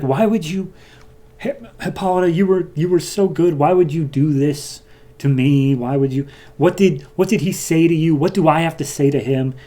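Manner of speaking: a lightly voiced hurt goddess; wounded Hera. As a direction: ask why like a betrayed queen. Hera's hurt reproach, retold.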